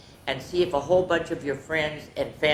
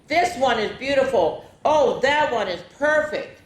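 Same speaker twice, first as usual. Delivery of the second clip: higher, delighted gushing; exclaiming; 8 semitones higher, 4 dB louder than usual. Acted. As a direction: admire the trees out loud.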